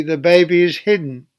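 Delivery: ordinary delivery, nothing marked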